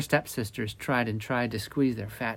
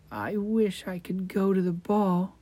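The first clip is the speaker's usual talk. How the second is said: sad; 8 semitones higher than usual. Acted. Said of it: "she cried".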